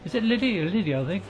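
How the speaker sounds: ordinary delivery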